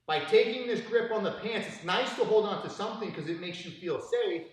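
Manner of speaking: retold scolding. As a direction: needle him like a fed-up coach.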